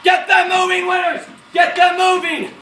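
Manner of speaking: high, forceful yelled command; Colonel Strayer hollering. Acted. Colonel urging an officer to move his men, hollering. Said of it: "Colonel Strayer was hollering at me".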